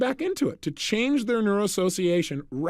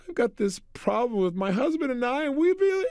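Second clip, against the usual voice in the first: tearful; 7 semitones higher than usual. Retold or imitated. Woman telling her problem, mock tearful wail. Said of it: "she began to cry like crazy".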